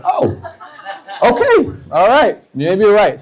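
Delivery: high-pitched, laughing surprise; taken aback. Retold or imitated. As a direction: concede in amused, high-voiced astonishment. Taken aback by the swearing kid.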